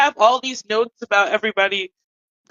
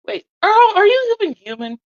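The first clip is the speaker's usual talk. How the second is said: high-pitched startled question; acted bewildered questioning, pitched way up; sudden disbelief; 12 semitones higher than usual. Player's character questions a friend's humanity, in high startled disbelief.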